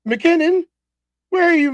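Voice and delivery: ordinary talk, nothing marked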